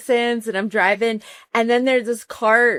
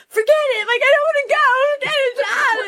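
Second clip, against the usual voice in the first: put-on upset teen. Teen daughter yelling at her dad, high whiny protest. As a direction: wail it like a frustrated teen.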